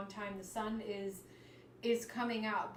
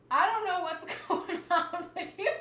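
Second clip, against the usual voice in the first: laughing through the words, higher and louder; real laughter; 9 semitones higher, 9 dB louder than usual. Real, not acted. Reader laughing at a surprising card, real laughter.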